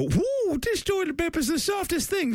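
very high, bubbly cartoon mascot; cartoon bear voice. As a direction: squeal it like a giddy ad bear. Voicing an animated ad bear.

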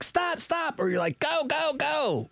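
high, urgent mock cries; mock shout. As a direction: call out like it hurts, half joking. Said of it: "Where you're like".

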